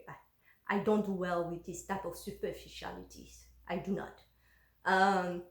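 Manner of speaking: normal talking voice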